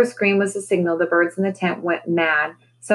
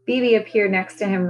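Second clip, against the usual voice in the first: ordinary delivery, nothing marked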